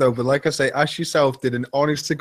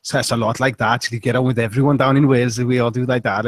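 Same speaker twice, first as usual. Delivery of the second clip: Welsh accent. Shown as a demonstration. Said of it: "How does it sound?".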